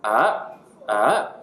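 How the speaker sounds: AH dipping low, then swinging up. Shown as a demonstration. Teacher attempting Mandarin third tone, dipping AH. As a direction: bend AH down and back up. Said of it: "Third tone goes down and up".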